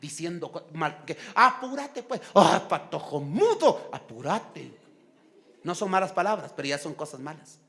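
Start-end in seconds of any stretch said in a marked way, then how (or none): 1.3-4.7 impatient mock scolding. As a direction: scold a child, only half yelling. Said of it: "no es bueno estar gritando". (regaño impaciente, actuado)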